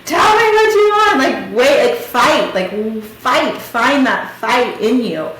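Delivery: high, loud plea, retold with force; desperate urging. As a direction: shake some fight into them. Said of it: "shaking him".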